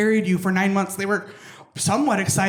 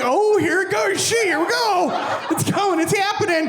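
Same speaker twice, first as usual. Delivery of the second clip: mock panic; 11 semitones higher than usual. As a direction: panic as you go down. Acting out falling.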